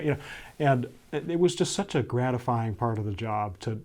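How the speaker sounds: usual speaking voice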